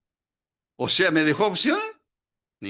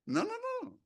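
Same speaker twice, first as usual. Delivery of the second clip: Jonah's refusal; 9 dB quieter than usual. Acted. Jonah refusing God's call.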